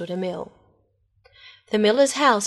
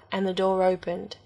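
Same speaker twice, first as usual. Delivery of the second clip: ordinary delivery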